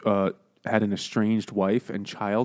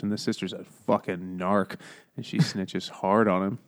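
normal talking voice

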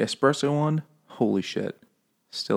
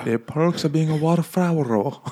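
mock thick Japanese accent; hammed-up Japanese accent; 7 semitones higher, 4 dB louder than usual. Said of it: "with a thick Japanese accent".

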